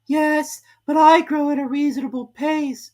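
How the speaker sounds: high, slow squeaky complaint; a small creature squeezed and cross; high, slow, loud Dormouse grumble; Dormouse complaining. A narrator's reading. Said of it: "said the Dormouse".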